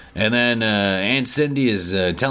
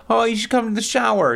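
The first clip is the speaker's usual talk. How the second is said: high sweet Kristen voice; 12 semitones higher than usual. Retold or imitated. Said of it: "Kristen's like".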